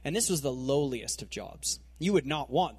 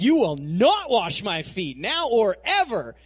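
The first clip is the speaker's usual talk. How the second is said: slow, louder, every word set down; firm loud refusal; 4 semitones higher, 7 dB louder than usual. Retold or imitated. Peter refuses his master, slow and loud. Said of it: "so he's like".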